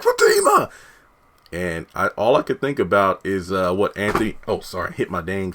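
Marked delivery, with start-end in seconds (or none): 0.0-0.7 high, loud desperate yell; yelling out a name. Retold or imitated. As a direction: shout after her as you're beaten. Man calls after her.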